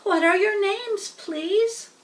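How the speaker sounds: put-on hopeful young pig asking; excited pig. Wilbur asks the spiders if they will stay, high and eager.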